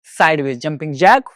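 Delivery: normal talking voice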